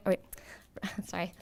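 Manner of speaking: ordinary talk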